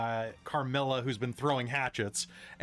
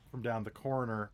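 ordinary talk, nothing marked

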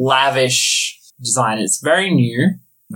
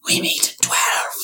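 whispered snake; 5 dB quieter than usual. Acted. Snake sets meeting, whispered.

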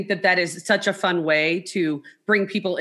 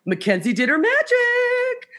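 very high, drawn-out cheer; singsong delight; slower than usual. Retold or imitated. Podcasters hearing their editor's finished work, singsong delight. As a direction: stretch it out high in praise.

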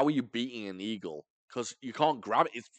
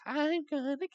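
put-on playful creepy kid; 14 semitones higher than usual. Otter hunting someone hiding in a room, playful child voice. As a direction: tease like a kid playing a scary game. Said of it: "what if he thinks it's, like, a game".

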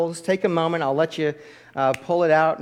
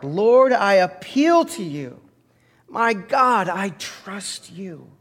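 heartfelt prayer; 5 semitones higher, 4 dB louder, much slower than usual. Shown as a demonstration. Pastor repeating the prayer with the church, fading at the end. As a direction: pray it from the heart and let the last words fall away. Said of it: "one more time with all your heart".

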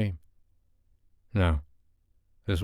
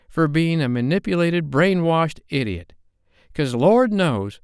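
put-on higher, louder young woman flaring up; rising temper; 9 semitones higher, 4 dB louder than usual. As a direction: let her temper boil over, pitched up. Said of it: "Her voice rose with his as her temper flared".

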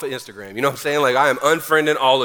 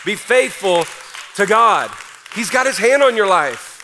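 louder, higher preacher's cry; full-force preaching; 6 semitones higher, 6 dB louder than usual. Acted. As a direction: preach it with everything you have.

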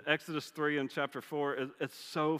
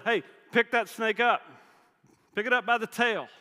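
higher and louder call; God's command; 8 semitones higher, 8 dB louder than usual. Retold or imitated. God ordering a fleeing Moses back, higher and louder.

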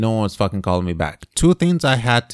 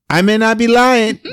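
laughing; 11 semitones higher than usual. Real, not acted. Laughs at his own accent demo.

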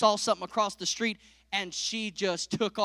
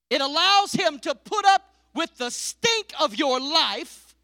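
loud, high, driving sermon voice; full-force preaching; 8 semitones higher, 9 dB louder than usual. Acted. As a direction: preach it hard to the congregation.

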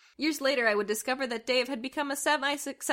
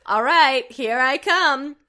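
hammed-up seductive; 3 semitones higher, 10 dB louder, much slower than usual. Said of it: "Dave said, looking forcefully".

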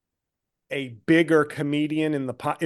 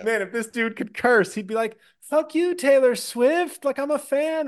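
imagined whiny Jo Koy; 8 semitones higher than usual. Retold or imitated. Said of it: "I was like".

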